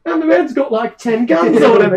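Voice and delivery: loud, high crewman's report; alarmed pirate. Acted. Pirate looking over to warn captain, alarmed report. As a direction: exclaim it up to the captain. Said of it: "one of the pirates is looking over and goes".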